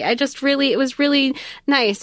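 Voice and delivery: normal talking voice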